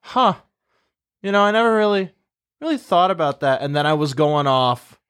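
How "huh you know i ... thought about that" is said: pitched up high, caught off guard; suddenly struck by the question, voice rising; surprised realization. Retold or imitated. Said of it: "I go".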